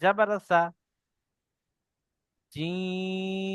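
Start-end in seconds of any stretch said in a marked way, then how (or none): 2.5-3.5 জিম held very long and slow; long drawn jim. Shown as a demonstration. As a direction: stretch জিম out like a chant. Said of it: "জিমটি তিন আলিফ টান".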